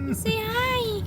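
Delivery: very high sweet puppy talk. Real, not acted. Talking to puppy.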